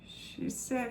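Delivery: usual speaking voice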